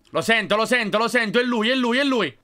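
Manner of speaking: high, loud and urgent; hurry, you've found it; excited. Real, not acted. Gamer closing in on the phone, excited rush.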